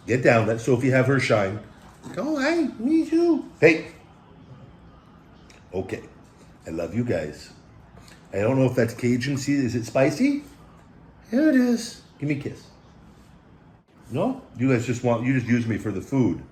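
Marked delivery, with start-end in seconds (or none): 2.1-3.9 much higher squeal at the dogs; squealy pet voice. Real, not acted. 11.3-14.4 pet voice. Real, not acted. Offering food to his dog.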